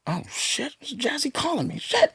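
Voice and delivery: high, hushed astonishment; startled disbelief. Retold or imitated. Woken at night by the producer calling, startled disbelief. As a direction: react in a high, softened panic. Retold startled wake-up to a call.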